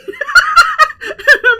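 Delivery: loud high burst of laughter; helpless shrieking laugh. Real, not acted. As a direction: crack up hard and loud. Player cracking up at table.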